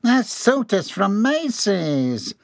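high, very slow whine; whining. A narrator's reading. Employee fretting over his grabbed suit, whining. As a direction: wail it with wounded vanity. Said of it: "John whined".

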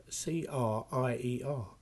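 letters spelled slowly one by one; spelling out. Shown as a demonstration. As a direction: spell each letter out clearly. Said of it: "I'm gonna spell crier".

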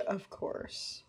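unvoiced, very soft; a hushed sarcastic echo; whispered sarcasm. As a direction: breathe it out dryly. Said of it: "He said".

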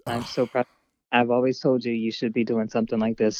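ordinary talk

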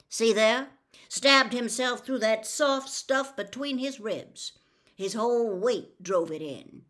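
loud, deliberate lawman showing how it happened; walk him through it step by step; sheriff demonstrating. Acted. Tate demonstrates the fall.

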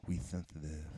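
We sensitive with every s lisped, thenthitive; exaggerated childhood lisp; full lisp. Shown as a demonstration. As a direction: lisp both s sounds in sensitive. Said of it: "or versus".

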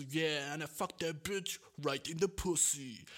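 slow, swaggering English rap delivery; mock rapper line. Imitating a crude rap verse he'd send to a producer, slow swagger. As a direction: lay it down like a cocky rapper. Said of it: "Sagen wir, du sagst so".

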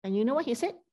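ordinary delivery

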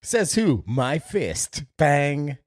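loud high hammed-up bravado; mock tough guy. Cohost plays a brawler in court, loud mock bravado. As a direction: punch each line like a cartoon brawler.

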